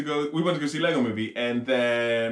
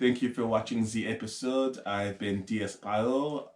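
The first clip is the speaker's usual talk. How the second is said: mock French accent; 4 semitones lower, 5 dB quieter than usual. Thanking viewers for watching, in mock French accent. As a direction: sign off as a put-on Frenchman, pitched down.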